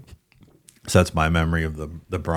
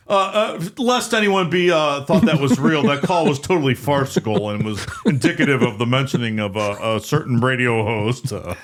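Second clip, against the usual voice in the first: mock sportscaster; 4 semitones higher than usual. Sportscaster warning viewers the call was fake, mock broadcast voice. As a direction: explain with halting on-air pomposity. Retold sportscaster debunking the prank.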